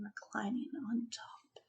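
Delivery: hushed so as not to wake him; whispered, creeping. A narrator's reading. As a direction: tiptoe whisper. Approaching the sleeping lion.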